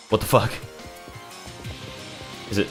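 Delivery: ordinary talk, nothing marked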